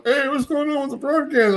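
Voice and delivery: high-pitched imitation of a puzzled listener; lightly mocking high caller voice; confused caller. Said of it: "people calling up going".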